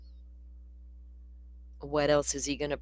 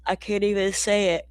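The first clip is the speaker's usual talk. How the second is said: yawning through words; 6 semitones higher than usual. Real, not acted. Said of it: "Yawning is so contagious".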